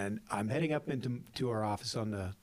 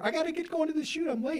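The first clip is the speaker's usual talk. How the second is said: high, fast and harried; mock panicky hurry; flustered rush; faster than usual. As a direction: imitate someone bolting out the door late. Retold employee rushing out late.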